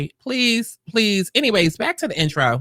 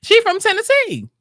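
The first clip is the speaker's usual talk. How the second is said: surprised squeal. Real, not acted. Reads search result, surprised.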